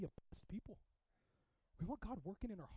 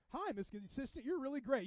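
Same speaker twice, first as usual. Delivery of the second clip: mock-friendly, lifted hello; lightly hammed-up flattering welcome; gushing greeting; 6 semitones higher, 4 dB louder than usual. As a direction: greet them with put-on approval. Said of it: "give you a weird side hug to go".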